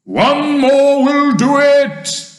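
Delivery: slow, high, loud yell; full-voiced excited shout. A narrator's reading. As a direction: holler encouragement, stretching the words. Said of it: "shouted the old green grasshopper".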